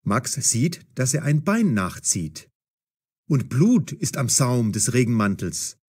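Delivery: usual speaking voice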